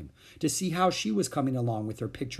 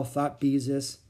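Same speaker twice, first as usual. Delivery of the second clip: ordinary delivery, nothing marked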